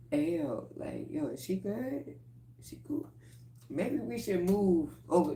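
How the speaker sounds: hushed aside to a friend; nervous aside. Retold or imitated.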